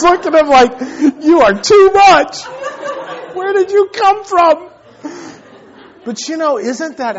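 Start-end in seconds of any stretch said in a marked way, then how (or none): 1.2-4.7 loud, high, slow disbelief; mock amazement. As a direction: marvel at your over-dramatic son, hammed up. Astonished dad answers his dramatic son.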